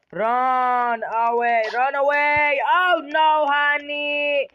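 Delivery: loud, high panicked cry to flee; panicked yell. Real, not acted. Player yells at pet to run away, loud and panicked.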